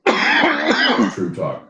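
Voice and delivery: drawn out, weighty is true talk; slow emphatic quote. Retold or imitated. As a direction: lean on every word slowly. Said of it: "And he said".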